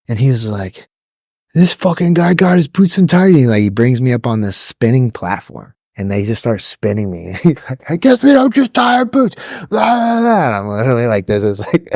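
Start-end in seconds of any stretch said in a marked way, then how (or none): 1.5-3.3 high, mocking drill instructor call-out; hammed-up DI sneer. Drill instructor spotting untied boots. 7.9-10.5 hammed-up drill sergeant taunt; drill instructor. Drill instructor mocking recruit.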